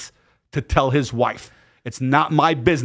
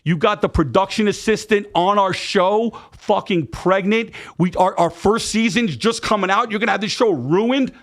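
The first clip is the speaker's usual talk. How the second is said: angry outburst; 7 semitones higher than usual. Retold or imitated. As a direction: tear into him, outraged. Furious reaction to the pregnancy news.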